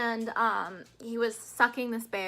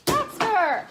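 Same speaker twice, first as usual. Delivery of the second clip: much higher, louder real shout; sharp shout at dog; 11 semitones higher, 10 dB louder than usual. Real, not acted. Owner catching her dog stealing food, a real sharp shout. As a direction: snap the dog's name to stop him.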